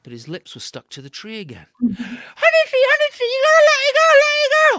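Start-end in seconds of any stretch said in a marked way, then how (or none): 1.8-4.8 very high loud desperate cry; frantic plea. A narrator's reading. Stuck Anansi begging the honey tree, very high and frantic. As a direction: beg the tree in panic.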